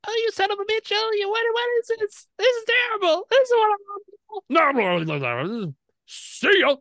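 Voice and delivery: a shrunken Minotaur squealing in outrage; tiny furious squeak. Acted.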